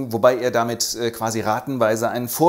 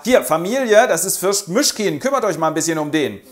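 loud, higher presenting call; hearty announcement; 5 semitones higher, 6 dB louder than usual. Acted. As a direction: introduce him to the family. General stellt Fürst der Familie vor.

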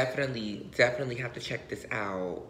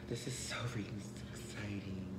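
soft unvoiced whisper of joy; 4 semitones lower, 11 dB quieter than usual. Real, not acted. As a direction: breathe it out thrilled. Whispering while opening.

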